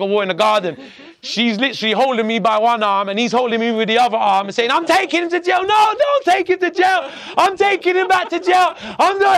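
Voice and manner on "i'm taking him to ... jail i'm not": high, loud acted-out argument; shouted tug of war. Mother and policeman fighting over him in the garden, frantic shouting. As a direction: shout both sides high, back and forth.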